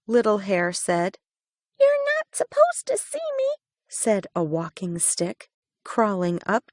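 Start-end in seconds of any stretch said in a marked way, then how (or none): 1.8-3.5 very high, fast squeaky insect; tiny squeaky voice. A narrator's reading. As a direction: chirp it quickly like a twig bug. Walking stick speaks.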